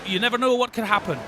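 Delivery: ordinary delivery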